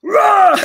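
loud, high imitated sports grunt; shout-grunt as if spiking a volleyball. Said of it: "we had to grunt".

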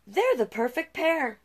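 delighted queen. Acted. Queen speaks to king.

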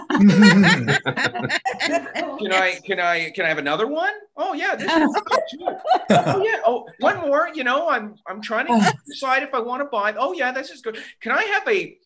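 greedy sample-taker. Pretend. Acting out sampling at See's.

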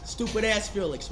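usual speaking voice

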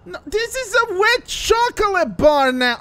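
high, loud, drawn-out lament; whining dismay. Acted. Soaked friend finds the chocolate bar ruined, dismayed. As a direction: wail over the ruined treat.

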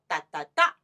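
short-short-long 哒哒哒; front-sixteenth rhythm. Shown as a demonstration. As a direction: tap out 哒哒哒, landing on the last. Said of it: "后面一个音叫前十六".

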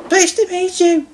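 very high-pitched, friendly engine voice; high smiling character voice; smiling little engine. A narrator's reading. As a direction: greet politely with a smile, high up. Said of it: "smiled Stanley".

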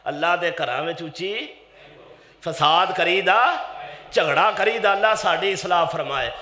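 ordinary delivery

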